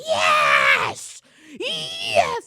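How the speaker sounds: shrieked high cheer, very loud. Retold or imitated. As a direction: scream it like a cheerleader on the sidelines. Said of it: "she be like".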